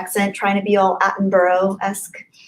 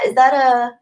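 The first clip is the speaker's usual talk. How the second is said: ordinary talk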